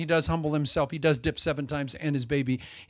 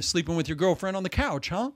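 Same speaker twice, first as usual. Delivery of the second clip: ordinary delivery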